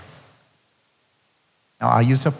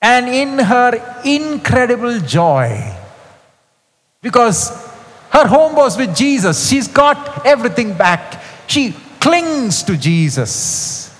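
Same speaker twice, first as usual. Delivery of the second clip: joyful; 8 semitones higher than usual. Real, not acted. Preacher swept up in Mary's joy, elated and high. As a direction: let the joy lift the voice high.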